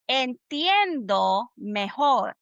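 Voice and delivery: "Entiendo mejor" slow, each word drawn out; deliberate, stretched "Entiendo mejor"; pronunciation model. Shown as a demonstration. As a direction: model "Entiendo mejor" at half speed for repeating. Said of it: "So this is what you're gonna say".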